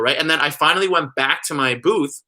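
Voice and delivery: usual speaking voice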